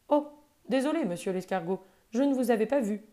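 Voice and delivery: a big gentle beast startled into apologizing; old buffalo apologizing. Acted. Old buffalo apologizes to the little snail, lightly voiced. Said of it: "dit le vieux buffle".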